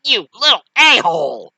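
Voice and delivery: loud, high cry then insult; hammed-up wounded outrage; pained angry yell. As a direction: yelp and curse the attacker. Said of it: "going like".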